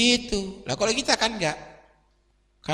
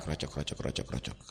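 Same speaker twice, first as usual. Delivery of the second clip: gurgling pour noise; 12 semitones lower, 13 dB quieter, faster than usual. Retold or imitated. Imitating gas pouring out.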